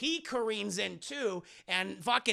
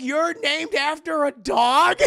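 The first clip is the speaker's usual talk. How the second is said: higher, louder, slow incredulous question; mock disbelief; 6 semitones higher, 9 dB louder, slower than usual. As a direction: sound amazed. Sala learns Indy's name.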